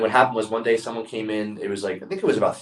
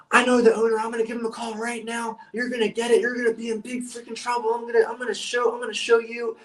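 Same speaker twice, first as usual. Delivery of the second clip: very high-pitched imitation of an angry customer; mock furious screaming; 13 semitones higher than usual. Irate customer threatens to call the owner, mock furious screaming. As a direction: threaten like a customer throwing a tantrum, voice way up. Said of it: "He was screaming in my face".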